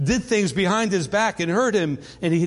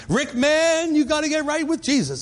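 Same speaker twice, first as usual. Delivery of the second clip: put-on Southern accent; 8 semitones higher than usual. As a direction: play Lenny in a thick Southern accent, pushing Rick toward faith. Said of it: "in a classic Southern accent".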